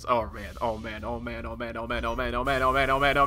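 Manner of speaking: oh man chant rising from hush; nervous anticipation. Real, not acted. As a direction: build tension under the breath.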